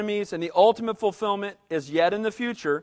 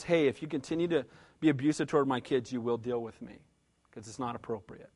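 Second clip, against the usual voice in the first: very low, soft, controlled retelling; calm warning; 9 semitones lower, 6 dB quieter than usual. Retold or imitated. Father warning man who abused his kids, calm. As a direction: warn him quietly, voice held well down. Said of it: "I was very calm".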